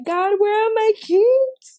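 high, loud, drawn-out panicked wail; freaking out. Retold or imitated. Woman searching for keys under threat, freaking out. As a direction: cry out to God in hammed-up panic, stretching the words. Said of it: "freaking out".

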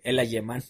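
"alemán" said "ayemán" in mockery; amused copy of the slip on "alemán"; mimicked mispronunciation. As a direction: tease with a Y for the L in "alemán". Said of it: "le empezaron a decir".

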